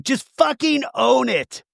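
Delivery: high, slow emphatic imitation; mock angry demand. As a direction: stretch out the order in hammed-up anger. Retold quarrel.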